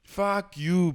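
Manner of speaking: normal talking voice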